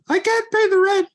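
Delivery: high, fast, pleading damsel voice; melodrama damsel. Acted. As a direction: squeal it like a distressed heroine.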